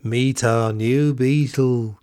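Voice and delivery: put-on ridiculous accent, drawn out very slowly; John's silly accent. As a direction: mimic a silly, exaggerated accent, stretching every word. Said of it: "He's got this funny accent".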